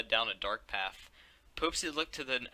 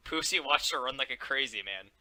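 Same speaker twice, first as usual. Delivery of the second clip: usual speaking voice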